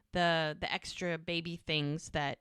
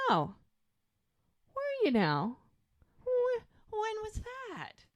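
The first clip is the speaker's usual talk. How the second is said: higher mock-suspicious voice, lightly hammed; suspicious girlfriend. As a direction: needle him like a jealous woman, pitched up. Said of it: "And she's like".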